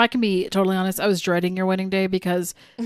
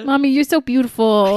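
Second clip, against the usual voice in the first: lightly voiced child, higher; a hint of an adoring little boy; imitated little son; 4 semitones higher, 4 dB louder than usual. Mother imitating her son's daily flattery, childlike sweetness.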